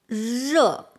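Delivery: "热" with a long buzzed r; demonstrated pronunciation. Shown as a demonstration. Teacher introducing the word for hot, "热" carefully drawn out. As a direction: draw out the buzzing onset of "热". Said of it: "This R with 呃, it pronounced like".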